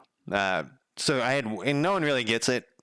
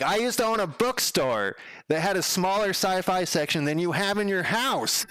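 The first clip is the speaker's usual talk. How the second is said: impressed marvelling; 8 semitones higher than usual. Retold or imitated. Delivery guy marvelling at books.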